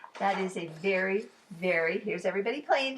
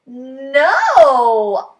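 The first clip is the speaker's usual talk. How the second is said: loud eager no; eager shout; 4 semitones higher, 18 dB louder than usual. Acted. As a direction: blurt it out. Child's eager answer.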